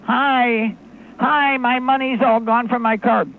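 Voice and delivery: a worried customer calling for help; caller voice. Pretend. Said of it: "So you call up".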